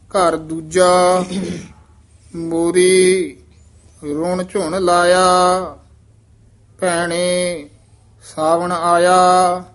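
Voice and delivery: loud, slow, drawn-out scripture reading; a teacher's loud, slow model reading; model recitation. Shown as a demonstration. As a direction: recite it out loud, stretching each word. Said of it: "ਇਹ ਮੋਰੀ ਪਾਠ ਹੀ ਉਚਾਰਨ ਕਰਨਾ ਹੈ".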